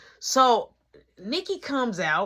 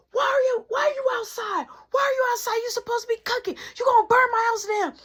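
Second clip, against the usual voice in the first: higher, shouting scold; a mother yelling at her kid to get back inside; scolding mom; 12 semitones higher than usual. Retold or imitated. Mom scolding her daughter for leaving the stove, a high shouted scold. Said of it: "My mama like".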